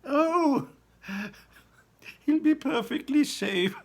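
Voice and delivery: high, loud laughing reassurance; high giggling Wonka. Acted. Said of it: "he said, giggling".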